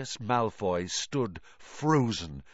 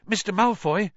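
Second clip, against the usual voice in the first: breathless call after running; gasping; 7 semitones higher, 4 dB louder than usual. Acted. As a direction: gasp it out, winded. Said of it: "he gasped".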